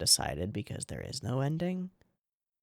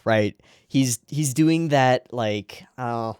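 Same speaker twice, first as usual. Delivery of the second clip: usual speaking voice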